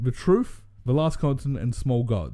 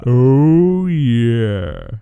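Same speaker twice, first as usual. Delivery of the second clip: low seductive drawl; slow it down and purr; sultry voice; 4 semitones lower, 5 dB louder than usual. Pretend. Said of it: "my sultry, sultry voice".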